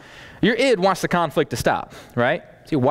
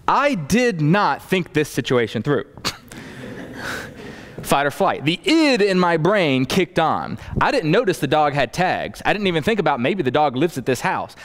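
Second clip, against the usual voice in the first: normal talking voice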